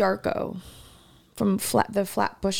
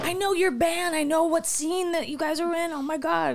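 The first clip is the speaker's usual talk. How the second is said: excited fan; 6 semitones higher than usual. Retold or imitated. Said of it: "And he's like".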